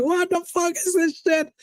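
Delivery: high mock outraged Ronaldo; angry Ronaldo. Mocking Ronaldo's rant about losing. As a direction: squeal out Ronaldo's fury in a mock voice. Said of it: "He was like".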